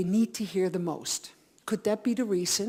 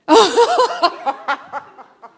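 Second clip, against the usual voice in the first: laughter; 9 semitones higher, 10 dB louder than usual. Real, not acted. Audience laughing.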